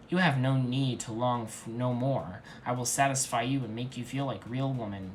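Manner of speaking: ordinary delivery, nothing marked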